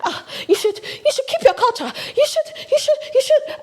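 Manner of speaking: hammed-up scolding commenter voice, high and giggly; mocking preachy commenters. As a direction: parody the know-it-all commenters, squeaky and laughing. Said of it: "you like to come".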